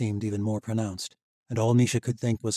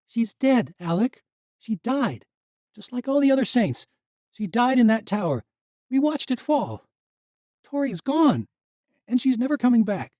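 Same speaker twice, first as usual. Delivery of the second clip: high, loud, anguished insistence; grieving anger; 8 dB louder than usual. Acted. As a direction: force him to accept she's gone. Misha, grief and anger.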